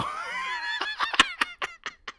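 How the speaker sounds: helpless high laughter; high laughing fit. Real, not acted. Laughing at his own mock grief. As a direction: crack up laughing.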